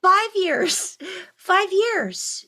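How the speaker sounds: high, bright-eyed excitement; rising up in delight at the anniversary; excited. Real, not acted. Telling friends the collab turns five, excited.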